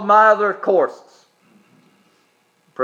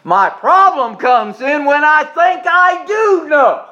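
slow, loud and high, driving the point home; rising preacher's cry; preaching shout; 5 semitones higher, 4 dB louder, slower than usual. Real, not acted. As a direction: shout it out from the pulpit.

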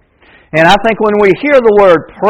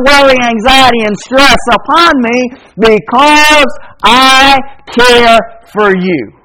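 higher, urgent preaching build; 4 semitones higher than usual. Acted. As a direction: climb toward the promise with fire. Building sermon passage.

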